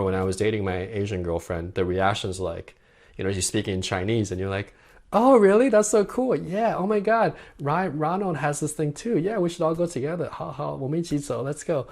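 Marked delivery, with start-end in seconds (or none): 5.1-11.9 high, excited, bubbly chatter. Retold or imitated. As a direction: gush like an eager date, way up high. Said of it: "more animated".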